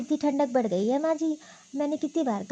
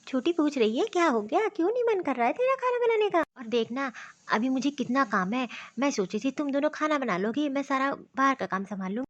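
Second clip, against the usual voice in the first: normal talking voice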